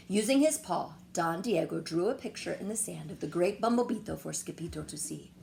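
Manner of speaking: ordinary delivery, nothing marked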